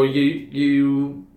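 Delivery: normal talking voice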